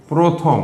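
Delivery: প্রথম with the first vowel said as o, "proth-om"; slow careful teacher demo of প্রথম; correct pronunciation. Shown as a demonstration. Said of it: "শুদ্ধ উচ্চারণ হচ্ছে প্রথম".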